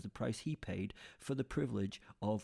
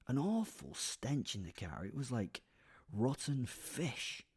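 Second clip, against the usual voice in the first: plain reading voice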